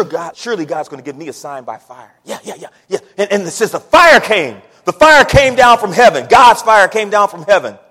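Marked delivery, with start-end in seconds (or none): 3.2-7.8 loud, high preaching climax; full-force preaching. Acted. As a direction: build it up and thunder.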